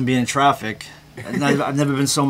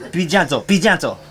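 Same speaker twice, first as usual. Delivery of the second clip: insistent mother, louder and higher, escalating; caring mother, mock; 4 semitones higher, 4 dB louder than usual. A mock caring mother to her tiny child, louder and louder. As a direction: start firm and build toward yelling. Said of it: "a caring mother, talking to her tiny".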